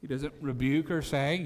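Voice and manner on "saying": ordinary talk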